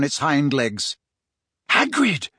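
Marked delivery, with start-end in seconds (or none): 1.7-2.3 panting. Acted. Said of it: "he panted".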